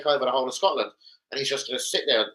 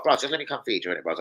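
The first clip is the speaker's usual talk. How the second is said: usual speaking voice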